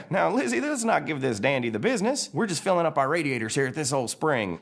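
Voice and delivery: put-on high old-timer car soothing his companion; Stanley. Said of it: "Stanley looks up and he's all".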